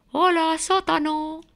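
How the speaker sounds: high, singsong mouse voice; ratón muy bajo. Acted. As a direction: greet it in a high little character voice. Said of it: "Y ratón muy bajo decía". (saludo agudo y cantarín de ratón)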